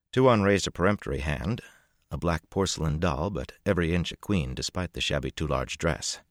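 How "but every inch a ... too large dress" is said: plain reading voice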